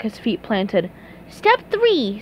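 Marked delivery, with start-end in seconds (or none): 1.3-2.2 high squeaky reading voice; SpongeBob reading. A narrator's reading. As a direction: read it out high and careful. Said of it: "SpongeBob read".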